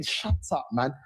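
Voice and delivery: usual speaking voice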